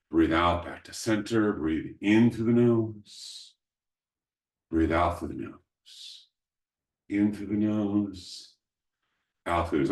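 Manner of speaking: guided breathing. Acted. Leads breathing cycles.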